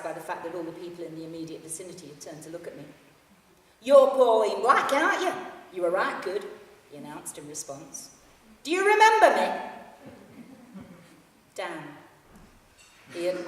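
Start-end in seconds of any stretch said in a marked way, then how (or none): 3.8-6.5 loud Yorkshire fan. Acted. Yorkshire fan recognizes Pauline on the train, loud broad Yorkshire. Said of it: "broad Yorkshire accent". 8.6-9.5 much higher and louder broad Yorkshire; eager Yorkshire fan. Acted. Yorkshire fan asks if she remembers him, high loud Yorkshire. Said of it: "broad Yorkshire accent".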